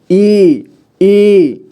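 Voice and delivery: slow, drawn-out ई as in बड़ी ई; long vowel ई. Shown as a demonstration. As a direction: stretch ई out for extra time. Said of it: "उसको ज़्यादा समय उच्चारण करेंगे".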